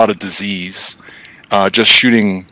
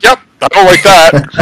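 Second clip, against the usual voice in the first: higher, louder mock-startled kid; mock kid reaction; 7 semitones higher, 5 dB louder than usual. Retelling childhood rifle kick, mock-startled higher kid voice. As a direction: recoil from a too-big rifle, half-joking.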